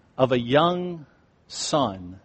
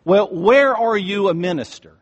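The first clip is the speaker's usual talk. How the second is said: retold question pitched higher and louder; mock raised waitress voice; voice raised a little; 7 semitones higher, 6 dB louder than usual. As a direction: lift the voice a notch, pointed. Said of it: "she raises her voice just a little".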